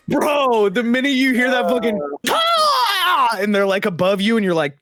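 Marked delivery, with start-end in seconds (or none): 2.3-3.4 high shrill mock shriek of the wife. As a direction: screech it like a swooping bird. Imitating wife calling out.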